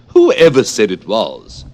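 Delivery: loud, slow, indignant queen voice; Red Queen. Acted. Red Queen rebuffing Alice, loud and indignant. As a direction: snap back with royal indignation. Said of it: "said the Red Queen".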